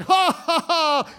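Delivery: put-on high "ha, ha, ha" as a boy who just missed; acted kid's reaction. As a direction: let out high, punched ha-ha-ha bursts. Kid reacts to missing the ball.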